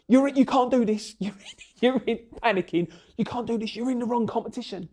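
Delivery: rough imitation of an insistent judge; Bob impression. Mimics Bob insisting he's in the wrong competition, high and loud. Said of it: "crappy impression of Bob".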